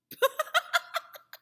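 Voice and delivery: bright giggle after a slip; high laugh at a flub. Real, not acted. Teacher laughs after stumbling on the twister.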